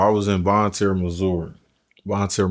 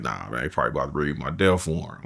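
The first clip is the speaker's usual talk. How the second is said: low, soft and quick, matter-of-fact; resigned; 6 semitones lower, 7 dB quieter, faster than usual. Retold or imitated. Condemned cellmate explaining calmly, low quiet resignation. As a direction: drop low and quiet, rushing through it. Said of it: "He's like".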